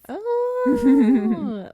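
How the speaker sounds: high, loud delighted oh; delighted squeal. Real, not acted. Reacting to sign.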